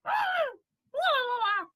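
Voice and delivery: high theatrical wail; hammed-up cry of distress; Mrs Bennet wail. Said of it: "imagine Missus Bennet being like".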